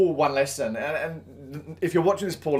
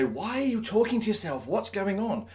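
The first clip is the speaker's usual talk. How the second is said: high, softer put-on voice for a puzzled pet; voicing Zero's thoughts; 6 semitones higher, 4 dB quieter than usual. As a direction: pipe up as the baffled pet. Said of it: "Zero's obviously looking at me as if to say".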